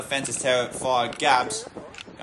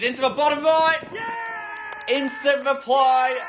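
goal call; 11 semitones higher than usual. Real, not acted. Commentator calls a goal.

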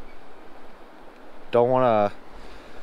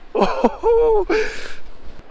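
squealing delighted laugh; joyful high laugh. Real, not acted. Laughing after catching bass.

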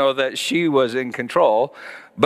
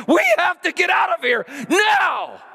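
high desperate shout, much higher; terrified scream. Retold or imitated. Daughter panicking in her ride seat, high terrified scream. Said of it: "starts screaming at the top of her lungs".

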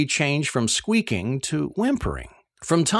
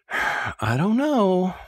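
slow, sighed-out reply; sighing; 5 semitones higher, much slower than usual. Retold or imitated. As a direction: let the words go out on a slow sigh. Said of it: "Lizzie sighed".

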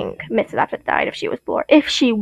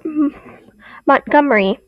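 usual speaking voice

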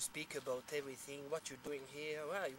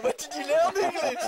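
much higher and louder, laughing through it; laughing question; 11 semitones higher, 16 dB louder than usual. Retold or imitated. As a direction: ask it while cracking up. Mocking question amid group laughter.

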